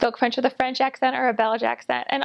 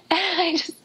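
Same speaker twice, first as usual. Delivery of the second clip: real laugh breaking into higher-pitched words; 6 semitones higher than usual. Real, not acted. Missionary recalling a door closed in her face, laughing. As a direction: start talking through a helpless laugh.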